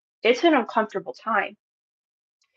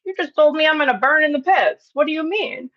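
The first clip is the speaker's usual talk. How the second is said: indignant retort; 7 semitones higher than usual. Acted. Retort to the church's condemnation.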